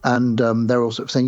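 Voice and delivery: usual speaking voice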